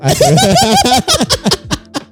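high shrieking laugh; big burst of squealing laughter. Real, not acted. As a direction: explode into high-pitched laughter. Exasperated banter with co-host.

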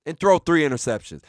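ordinary talk, nothing marked

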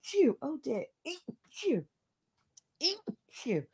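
breathy after a sneeze; recovering from sneezes; sneezing. Real, not acted. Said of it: "Sorry, I'm gonna sneeze".

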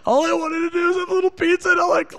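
high, hurried whine of self-pity; mock whining. Overfull driver moaning about a little pizza, mock whining. Acting out his overfull misery.

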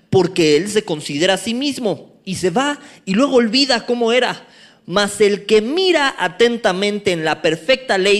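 loud, ringing scripture reading; fiery preacher reading the text aloud; preaching at full force. Acted. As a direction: proclaim the verses to the whole church.

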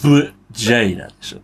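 "ブジャイナ" split after ブ, a gap before ジャイナ; slow, halting attempt. Shown as a demonstration. Co-host tries out the foreign word, slow and halting. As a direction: sound out "ブジャイナ" in two careful, separate pieces. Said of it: "早く".

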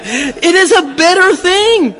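high, slow, put-on lofty voice; mock pompous quote. Preacher mocks Paul's wording for the congregation, high and lofty. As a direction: ham up the grand phrasing. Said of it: "Who talks like that?".

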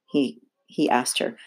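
ordinary delivery, nothing marked

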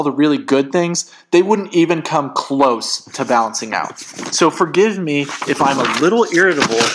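worked-up, self-justifying outburst; heated rant. Acted. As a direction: pour out a bitter argument. Breathing heavy, ranting in quarrel.

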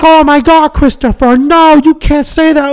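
high-pitched scolding mother; shocked mom. Retold or imitated. Mom scolding her son for swearing, high and shocked.

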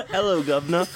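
hammed-up Cockney greeting; mock British accent. Co-host teasing the British host, mock British accent. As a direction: tease with a cartoon British accent, laughing. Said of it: "be respectful of my accent".

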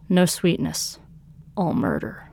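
plain reading voice

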